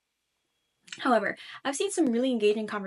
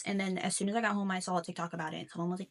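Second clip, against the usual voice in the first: ordinary talk, nothing marked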